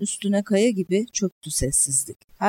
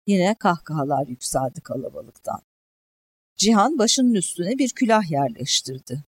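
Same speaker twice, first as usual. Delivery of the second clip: usual speaking voice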